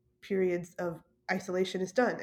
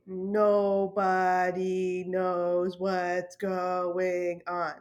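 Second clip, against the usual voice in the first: very slow, each word stretched; deliberate, dragged-out nobody knows; drawn-out emphasis; much slower than usual. Real, not acted. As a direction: spell out the take-home point slowly. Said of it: "here's the real take-home point".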